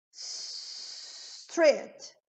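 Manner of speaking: "Street" opening on a very long hissed S; blown initial S. Shown as a demonstration. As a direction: hold the S, then release "Street". ("Street" soprando bem o S)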